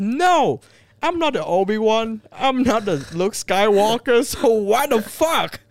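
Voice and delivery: loud, high-pitched indignant retort; exasperated outburst. Retold or imitated. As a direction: act out a worked-up protest, voice way up. Heated retort retold to director.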